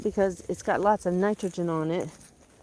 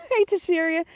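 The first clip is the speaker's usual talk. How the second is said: sing-song pet greeting; 10 semitones higher, 4 dB louder than usual. Real, not acted. Calling out to the pet.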